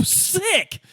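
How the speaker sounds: ordinary talk, nothing marked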